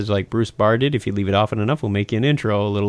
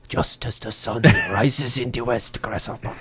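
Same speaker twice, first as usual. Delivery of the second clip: grasshopper voice; 2 semitones lower, 7 dB quieter than usual. Shown as a demonstration. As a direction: intone it like a kung fu sage. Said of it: "say that in your little grasshopper voice".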